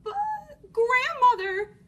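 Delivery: high, loud, drawn-out dismay; alarmed little girl. Acted. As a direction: gasp it out in mounting alarm. Girl sees the sharp teeth.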